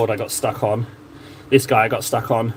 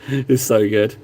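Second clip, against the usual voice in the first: ordinary delivery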